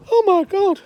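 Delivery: high, very loud shriek; old woman screaming at a shocking find; horrified scream. Acted. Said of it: "we hear a scream".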